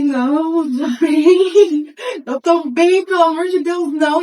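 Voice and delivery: panicked refusal. Retold or imitated. Retold panicked refusal to uncle.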